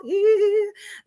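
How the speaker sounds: high giggle; high laugh. Real, not acted. Host giggling after her sing-song goodbye, high laugh. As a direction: giggle at your own silly voice.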